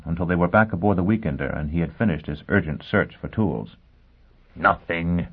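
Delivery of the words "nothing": impatient. Acted. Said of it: "he exclaimed impatiently".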